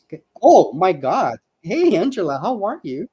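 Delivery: usual speaking voice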